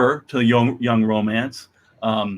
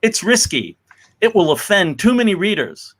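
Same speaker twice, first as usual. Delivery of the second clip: raised, higher warning voice; warning old timers; 7 semitones higher than usual. Acted. Old timers warn the editors, raised and higher. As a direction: warn them off like a worried veteran. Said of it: "we were warned by old timers".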